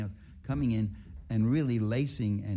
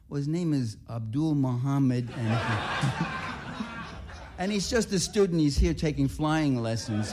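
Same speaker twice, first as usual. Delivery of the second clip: joking reply, high and breaking into laughter; laughing mock answer; 5 semitones higher than usual. Traveler joking to the officer about his friend, laughing. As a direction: tease the officer through giggles. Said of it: "I was actually tempted to say".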